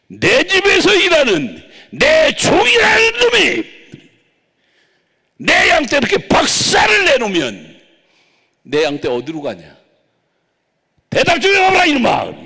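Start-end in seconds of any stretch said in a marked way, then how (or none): 0.1-7.7 much higher, much louder shouted rebuke; the Lord scolding his servant, shouting. Retold or imitated. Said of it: "주님이 야단치게". 11.1-12.4 Lord scolding. Retold or imitated. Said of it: "야단치는".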